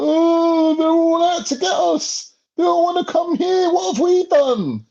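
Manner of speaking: high, whining mock of a self-pitying victim; whiny victim voice. Mocking Europeans who always play the victim, in a high whine. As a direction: squeal it like someone playing the victim. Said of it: "play the victim".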